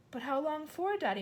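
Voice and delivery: put-on high, worried child; a girl begging to know; girl crying out. A narrator's reading. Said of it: "Matilda cried".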